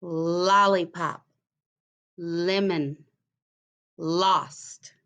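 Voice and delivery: lollipop, lemon, lost with the L drawn out slowly; onset stressed words. Shown as a demonstration. Teacher to new pupils, stressing each first sound. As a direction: slowly lean on the L at the start of each word. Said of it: "So listen very carefully".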